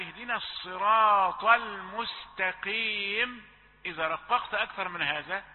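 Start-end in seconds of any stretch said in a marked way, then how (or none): 0.0-3.5 slow recitation. Shown as a demonstration. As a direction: recite المستقيم slowly, with a light qaf. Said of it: "كما في قول الله تعالى". (تلاوة بطيئة)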